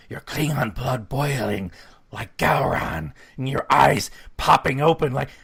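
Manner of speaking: slow, drawn-out excited build; worked up, leaning hard on the words. Real, not acted. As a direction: work yourself up, stretching each word with relish. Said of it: "I'm getting very excited here".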